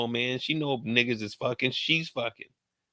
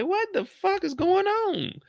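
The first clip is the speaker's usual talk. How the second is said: much higher, slow squeaky disbelief; shocked squeak; slower than usual. Retold or imitated. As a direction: stretch it high in disbelief. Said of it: "I said".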